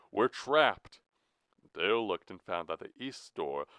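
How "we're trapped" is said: high, loud cry of dread; alarmed cry. Acted. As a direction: cry out that escape is gone. Elmer yelps, finding no escape.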